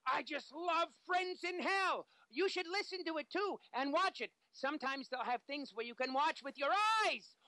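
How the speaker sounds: zany cartoon hero, very high voice; high manic promo rising to squealed shouts; Freakazoid. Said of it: "word from our good friend, Freakazoid".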